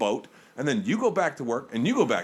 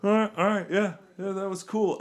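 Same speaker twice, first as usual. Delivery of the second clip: higher, wistful letdown; forlorn resignation; 9 semitones higher than usual. Retold or imitated. Alone after summer ends, resigned and higher. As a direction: sound lost after the party ends, higher. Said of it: "standing there going".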